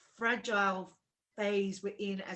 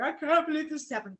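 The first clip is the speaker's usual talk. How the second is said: retold crying; 7 semitones higher, 5 dB louder than usual. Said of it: "I was crying".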